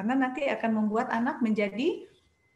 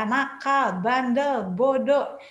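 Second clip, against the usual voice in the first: mock scolding; 6 dB louder, much slower than usual. Shown as a demonstration. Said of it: "kata-kata negatif".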